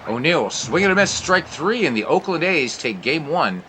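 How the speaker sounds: high, loud rising call on the final strike; excited strikeout call. Acted. Broadcaster calling the game-ending strikeout, excited and loud. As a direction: sell the last out like a big moment.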